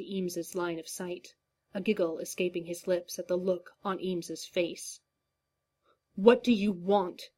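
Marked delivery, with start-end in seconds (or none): 6.1-7.3 louder, hard-edged demand. A narrator's reading. Eames confronts the clown, demanding and loud. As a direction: push back at the captor. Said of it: "Eames demanded".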